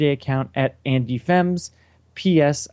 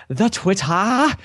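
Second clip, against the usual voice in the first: [name]; 6 semitones higher, much slower than usual. Retold or imitated. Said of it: "as [name] says".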